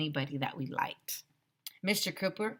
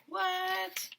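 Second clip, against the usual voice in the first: high, soft, baffled what; mock bewildered boy; 13 semitones higher, 4 dB quieter than usual. A narrator's reading. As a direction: gape at the picture. AJ's face in picture.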